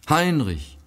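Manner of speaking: sudden high cry of his name; cry of recognition. A narrator's reading. Sick sister recognizes her lost brother: high startled cry. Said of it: "entrang sich ein Schrei ihren Lippen".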